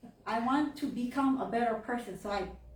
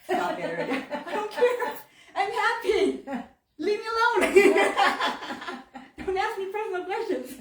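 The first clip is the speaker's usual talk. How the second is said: exasperated outburst; 11 semitones higher, 8 dB louder than usual. Pretend. Exasperated retort to pregnancy questions.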